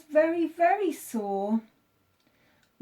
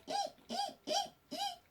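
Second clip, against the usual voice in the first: very high soft squeaks; hamster squeak; 10 dB quieter than usual. A narrator's reading. Hamster at the vet squeaking softly. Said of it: "the hamster said".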